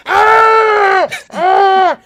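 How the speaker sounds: high, loud frightened scream; imitating a daughter's horror-movie scream; imitated scream. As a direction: shriek like a scared kid. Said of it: "she's just like".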